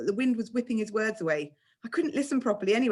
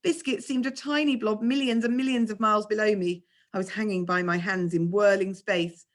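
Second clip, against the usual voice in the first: usual speaking voice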